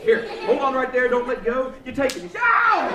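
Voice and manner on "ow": loud, high "ow"; comic pain yelp. Pretend. Magician stretching a balloon with child, pained yelp. Balloon snaps on his hand.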